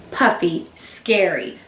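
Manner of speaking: ordinary delivery, nothing marked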